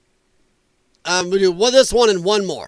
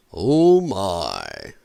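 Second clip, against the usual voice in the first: low, drawn-out Sulu oh my; 12 semitones lower than usual. Shown as a demonstration. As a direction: try Sulu's deep oh my once more. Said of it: "I will work on getting my voice back".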